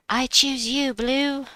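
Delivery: playful call-out. Pretend. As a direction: call your pick like a trainer sending out a monster. Calling out a game pick.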